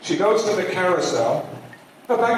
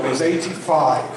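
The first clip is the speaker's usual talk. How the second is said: ordinary delivery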